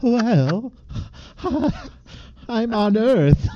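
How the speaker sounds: put-on high, slow, otherworldly old lady; old lady alien voice. Reprising the alien old lady voice for a laughing friend. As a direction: play the old lady as if she were an alien. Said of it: "your old lady sounded like an alien".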